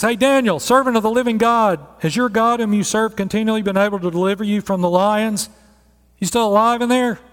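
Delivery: king calling loudly, high and anxious. Acted. King calling to Daniel in the den, loud and anxious. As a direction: call down into the den.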